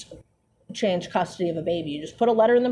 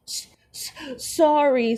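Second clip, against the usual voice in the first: a breathy stammer that breaks into a higher "sorry"; stammered sob; 6 semitones higher, slower than usual. A narrator's reading. Hagrid choking out an apology to McGonagall, mock sobbing. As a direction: choke out the apology through tears. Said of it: "sobbed Hagrid".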